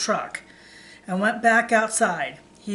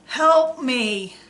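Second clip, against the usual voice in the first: moaning; 3 semitones higher, 4 dB louder than usual. A narrator's reading. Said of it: "the officer moaned".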